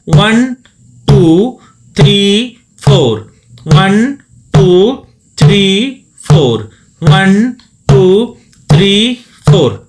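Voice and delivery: loud, steady count over the drum; counting aloud. Shown as a demonstration. Dholak teacher counting beats for students, loud. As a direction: call out each beat loudly. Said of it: "आपको ऐसा practice करना है".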